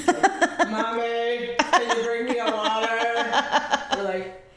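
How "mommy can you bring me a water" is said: mock monotone kid. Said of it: "his voice is so monotone sometimes".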